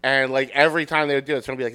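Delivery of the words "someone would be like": ordinary talk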